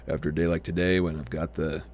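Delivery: deep lumberjack voice after a long day. Real, not acted. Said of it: "I got a bit of a lumberjack voice going on anyway".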